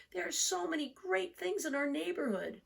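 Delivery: put-on an undecided young turtle explaining; Franklin's child voice. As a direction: let it rise, a bit worried.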